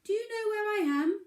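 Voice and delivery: high, loud little pebble voice. Acted. Pebble calls out to the moose, high eager voice. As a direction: pitch it way up as the small, eager pebble. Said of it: "the pebble said".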